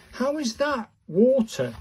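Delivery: can't believe it, pitch way up; how on earth, exasperated; incredulous. Real, not acted. Repeated disbelief.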